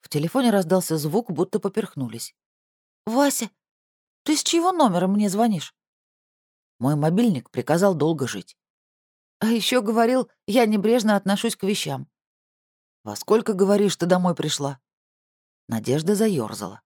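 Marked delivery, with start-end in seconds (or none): 3.1-14.8 sister voice. Acted. Sister voice, brother replies.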